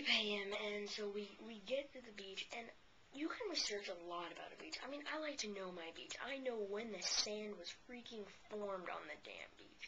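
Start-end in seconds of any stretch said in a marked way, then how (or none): none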